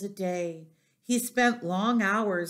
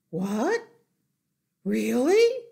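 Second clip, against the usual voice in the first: rising, loud hopeful disbelief; astonished cry; 5 semitones higher, 7 dB louder, slower than usual. A narrator's reading. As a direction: cry out as an amazed piglet. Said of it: "cried Wilbur".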